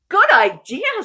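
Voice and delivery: high, loud, eager agreement; excited uncle. Acted. Uncle eagerly agreeing to sing the songs. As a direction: jump in with a bright "Good idea".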